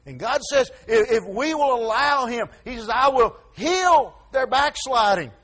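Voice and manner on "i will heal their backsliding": higher and louder, slow and emphatic; God's promise proclaimed. Acted. Preacher proclaiming God's promise to the congregation, slow and emphatic. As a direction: lean on heal like a sure promise.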